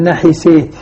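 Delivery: نحسيت with the long aa tilted to "ee": na-hi-seet; imala of the seen. Shown as a demonstration. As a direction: bend the vowel after س toward e in نحسيت. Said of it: "أمال السين".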